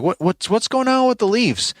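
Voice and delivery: high-pitched demanding mock complaint; confrontational.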